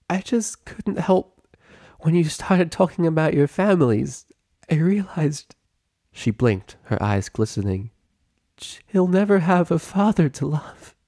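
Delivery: high, tearful voice on the edge of a sob; holding back tears as she confesses. A narrator's reading. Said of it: "faded away into a sob".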